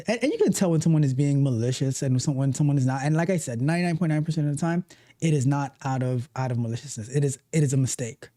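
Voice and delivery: ordinary talk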